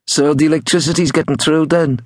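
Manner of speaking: high-pitched Liverpudlian caller. A narrator's reading. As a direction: quiz them in a high Liverpudlian accent. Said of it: "in his best Liverpudlian".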